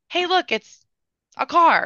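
put-on a small child pointing at his own shirt; excited little boy.

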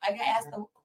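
squeaky high, suddenly soft; high mock voice. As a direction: pipe up in a tiny high voice. Mock voice asking to pee.

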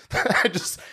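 high giggle breaking through; laughing at own French try. Real, not acted.

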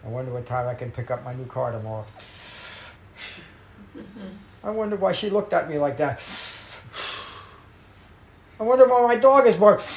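quick chatter rising to a loud, high, fast burst; restless thoughts. Retold or imitated. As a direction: act out a mind racing out of control. Wandering thoughts voiced in meditation.

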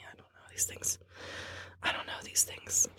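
hushed unvoiced whisper. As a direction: whisper it under your breath. Repeated whispered joke.

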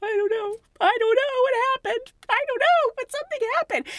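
bewildered, pleading confusion; flustered bewilderment. Retold or imitated. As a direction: stammer it out, totally lost. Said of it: "And I'm like".

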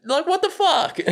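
high, squeaked what the fuck; freaked out disbelief; host reacts to the family listening in, very high; shocked. Real, not acted.

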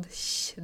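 a long unvoiced "sj" hiss, no vowel; sj sound. Shown as a demonstration. Teacher showing students the Norwegian "sj" sound. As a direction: breathe out "sj" softly, like a hush. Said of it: "den lyden der".

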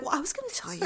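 imitating a very soft, guilty aside; below his breath. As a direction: mutter it quietly, half to yourself. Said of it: "below his breath".

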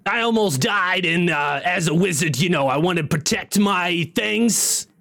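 imperfect comedian impression, explaining himself; Gilbert Gottfried. Wizard explaining why he became a stone, imitating Gottfried. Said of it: "sounds like Gilbert Godfrey".

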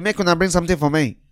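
lightly voiced Nigerian officer demanding; casual pidgin demand. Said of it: "He said".

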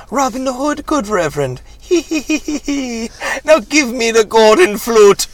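very high, squeaky imp with a giggle; loud, piercing pixie villain; leprechaun voice. Acted. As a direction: cackle and demand like a wicked little leprechaun. Plays the leprechaun in script reading.

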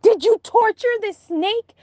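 high, shocked accusation pitched well up; horrified question. Retold or imitated. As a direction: confront her in disbelief. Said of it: "And I'm like".

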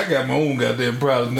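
usual speaking voice

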